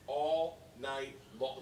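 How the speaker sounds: "All night long" stretched slowly; dragged-out emphasis. Real, not acted. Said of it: "And then we drank all night long".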